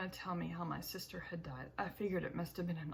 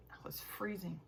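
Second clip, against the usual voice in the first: whispered; 3 semitones lower, 8 dB quieter than usual. Acted. Freezing in snow.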